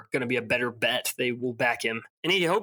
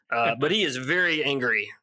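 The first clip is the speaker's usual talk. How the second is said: ordinary talk, nothing marked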